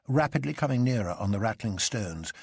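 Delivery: ordinary delivery, nothing marked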